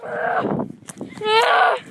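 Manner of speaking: usual speaking voice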